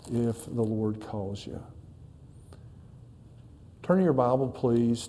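ordinary talk

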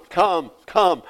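usual speaking voice

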